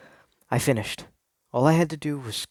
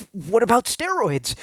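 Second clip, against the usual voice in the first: high nervous question; 9 semitones higher than usual. Acted. Timid question.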